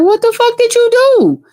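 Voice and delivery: very high-pitched mock outrage; outraged cousin. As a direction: squeal the question as an appalled cousin. Cousin's outraged reaction, retold.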